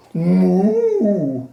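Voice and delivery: long, loud cow moo. A narrator's reading. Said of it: "said the cow".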